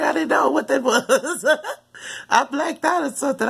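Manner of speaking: high, laughing through the words. Real, not acted. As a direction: laugh it out in disbelief. Laughing at her own story.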